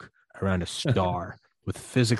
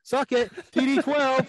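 much higher, mocking brag; gloating Tom Brady; 3 dB louder, slower than usual. Tom Brady taunting the other players after his record, high gloating voice. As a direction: crow it like a cocky champ rubbing it in.

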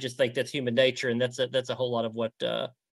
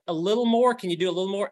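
eager coaxing for more; coaxing request; 6 semitones higher, faster than usual. Retold or imitated. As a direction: pitch lifted, urging for more. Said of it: "and she'd say".

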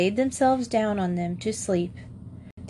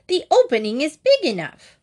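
high, louder witch scolding; impatient witch; 6 semitones higher, 4 dB louder than usual. A narrator's reading. Witch scolds Gretel about the oven, high and impatient. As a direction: snap at the silly girl. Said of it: "said the old woman".

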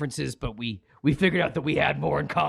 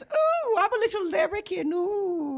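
put-on high, ghostly little kid wail; spooky kid voice. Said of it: "It sounds like this".

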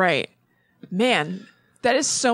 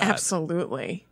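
normal talking voice

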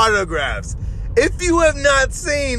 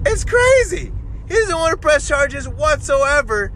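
incredulous exclamation; 4 semitones higher than usual. Real, not acted. Podcaster amazed Arnold won't press charges, incredulous exclamation. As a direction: pitch leaping high in disbelief.

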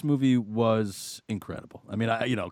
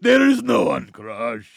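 higher, louder mock brute voice; imitating Crusher; 8 semitones higher, 8 dB louder than usual. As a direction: echo the line as a hammy giant. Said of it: "I fucking love that bit".

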